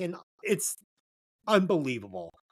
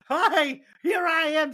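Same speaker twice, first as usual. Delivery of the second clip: mocking a cheesy Disney hero, pitched higher; lightly hammed-up sappy romantic lead; 8 semitones higher, 3 dB louder than usual. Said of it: "this horse shit Walt Disney garbage".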